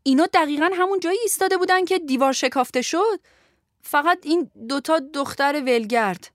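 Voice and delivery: high-pitched self-defence; defensive. Acted. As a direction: plead your case to an angry boss. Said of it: "نگهبان از خودش دفاع کرد". (با لحن دفاعی و صدای زیر)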